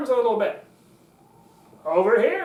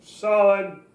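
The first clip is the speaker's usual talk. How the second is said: lowered, firm authoritative word; deep-set, steady authority; low and solid; 5 dB louder than usual. Shown as a demonstration. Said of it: "that's how it works".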